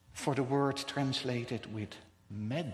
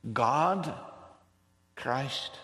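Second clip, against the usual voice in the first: usual speaking voice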